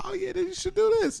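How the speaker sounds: mock helpful voice. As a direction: play the overeager idea man. Mock-advising someone eagerly.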